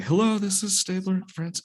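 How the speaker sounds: high, bright announcer hello; radio DJ voice. Retold or imitated. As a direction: overdo the radio host intro. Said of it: "Shit, that doesn't sound good".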